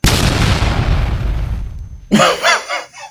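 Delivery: a high, breathless burst of laughter; incredulous wheezing giggle; high unvoiced laugh. Real, not acted. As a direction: laugh in disbelief, high and without voice. Laughing at the government's logic.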